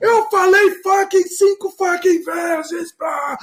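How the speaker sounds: high, drawn-out mock shouting; slow, hammed-up screamed swearing; retold angry shout. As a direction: imitate an irate caller yelling abuse. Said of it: "E gritando".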